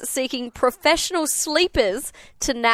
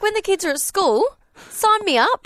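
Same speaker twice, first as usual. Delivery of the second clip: eager; 7 semitones higher than usual. Pretend. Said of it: "I'd be like".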